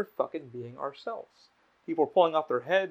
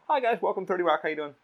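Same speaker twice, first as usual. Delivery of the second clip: high, raised welcome that settles at the end; acted chirpy greeting; 4 semitones higher, 5 dB louder than usual. Worker greets people walking up at Thirty Rock, put-on chirpy greeting. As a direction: put on a customer-facing hello. Acts out greeting visitors at work.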